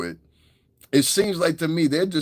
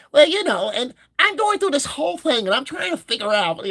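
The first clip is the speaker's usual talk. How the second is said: imitating a podcaster in a much higher put-on voice; mock high podcaster voice; 14 semitones higher, 3 dB louder than usual. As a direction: go way up high and dainty to mock them. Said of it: "they be talking like that".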